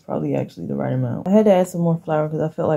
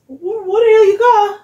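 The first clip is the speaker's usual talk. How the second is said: startled outburst; 9 dB louder than usual. Real, not acted. Mom startled by her son, high surprised yelp. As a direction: blurt it out in real shock.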